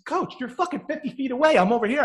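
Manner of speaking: high, pleading mock complaint; exasperated protest. Batter to coach fifty feet away, exasperated. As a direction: argue back like a fed-up batter.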